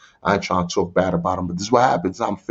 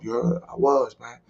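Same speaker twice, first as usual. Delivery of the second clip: normal talking voice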